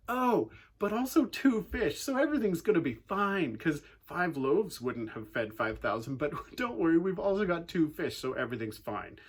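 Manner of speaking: sarcastic. Retold or imitated. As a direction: pretend everything is fine. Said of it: "they get sarcastic".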